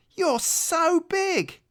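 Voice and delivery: very high, slow awed gasp; amazed. Acted. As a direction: marvel at how huge he is. Tiny rabbit awed by bear.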